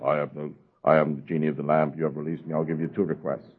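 low, soft genie of the lamp. Retold or imitated. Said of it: "Remember Aladdin's lamp?".

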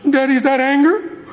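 put-on high, rising child's question; little girl. Little girl asks her daddy, high questioning child voice. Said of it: "And the little girl says".

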